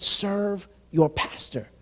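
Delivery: very slow, weighty divine instruction; slow, grave voice of the Lord; slow solemn command. Retold or imitated. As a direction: let each word land like an order from God. God's command retold.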